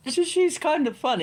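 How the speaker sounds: high, loud Hogwarts teacher voice; posh lady voice. Pretend. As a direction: channel a prim, proper schoolmistress. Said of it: "I might do the readings in this voice".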